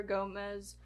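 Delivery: ordinary delivery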